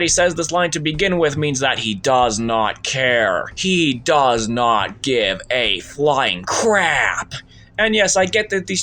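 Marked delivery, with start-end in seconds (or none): 1.8-7.3 "does not give a flying crap" slow, word by word; emphatic condemnation. Real, not acted. Reviewer condemning Wonka's indifference to the children, slow emphatic disgust. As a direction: hammer out each word of "flying crap", slowly.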